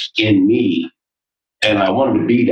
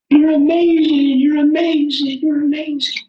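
echoed praise. Retold or imitated. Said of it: "Pay attention".

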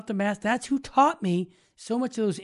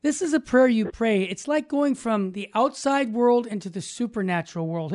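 ordinary talk

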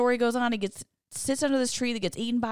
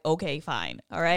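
lower, grudging reluctant okay; begrudging; 6 semitones lower than usual. Retold or imitated. Jonah answering God's order, mock begrudging. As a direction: give in with a sulky sigh. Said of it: "he's begrudgingly like".

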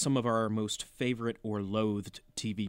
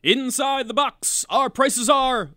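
home shopping host; 10 semitones higher, 8 dB louder, slower than usual. Acted. Co-host continues the mock ad.

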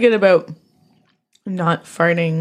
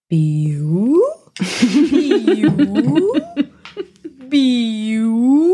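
fart noise imitation; 5 semitones higher, much slower than usual. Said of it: "Dave's butt went".

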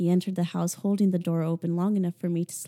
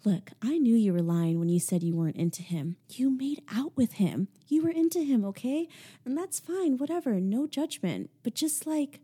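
higher, half-whispered disbelieving friend; Ashley, incredulous; 5 semitones higher than usual. Acted. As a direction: push her friend, stunned and pressing. Ashley lecturing her friend.

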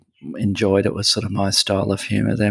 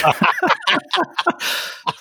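high, breathless giggle at his own slip; burst of laughter. Real, not acted. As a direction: crack up at the botched name. Giggling at own name mix-up.

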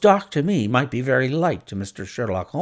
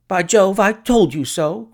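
higher, louder excited outburst; excited cry; 8 semitones higher, 6 dB louder than usual. A narrator's reading. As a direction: cry it out, pitched up and loud. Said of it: "cried the clerk".

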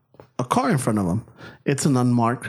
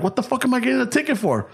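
higher, fast disbelief; outraged; 6 semitones higher, faster than usual. Retold or imitated. Driver protesting the ticket, fast and higher. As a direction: fire it off in indignation.

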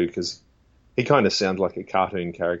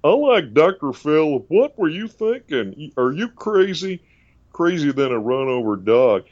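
high, slow Texan TV-host scolding; Doctor Phil impression; 6 semitones higher, 4 dB louder than usual. Doctor Phil scolds a guest in a Texan drawl. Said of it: "a little bit of his voice".

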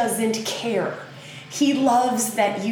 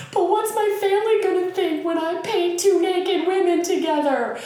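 anxious high worry; 10 semitones higher than usual. Acted. Artist fearing her family's judgment, high and anxious. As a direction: squeak out the worry. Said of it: "And you're sitting here going".

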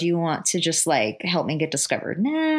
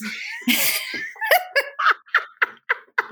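long high laugh. Real, not acted. Laughing at own joke.

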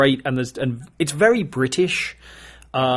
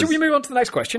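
squeaky fast voice; 13 semitones higher, much faster than usual. Acted. Joking about the long sentence.

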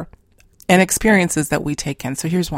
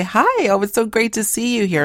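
eager greeting; 6 semitones higher than usual. Retold or imitated.

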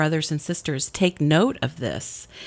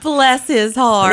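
put-on Southern accent; 8 semitones higher, 9 dB louder, slower than usual. Joke about Southern sweet insults.

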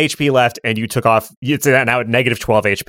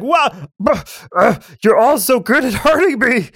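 high, impressed grudging ogre; 6 semitones higher than usual. Acted. Ogre tells the party it respects them, high. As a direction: play the ogre, begrudgingly impressed. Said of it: "and now it's like".

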